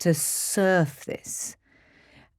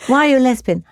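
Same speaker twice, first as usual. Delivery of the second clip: higher, louder startled question; retold incredulous mum; taken aback; 8 semitones higher, 5 dB louder, much faster than usual. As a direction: blurt it as a thrown parent, retold. Retold startled mother questioning her child.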